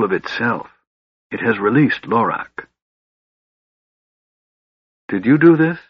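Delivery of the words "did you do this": plain reading voice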